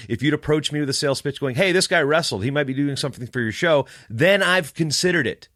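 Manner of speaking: ordinary talk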